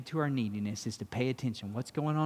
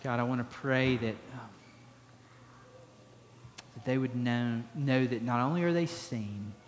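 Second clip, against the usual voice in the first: closing prayer. Acted.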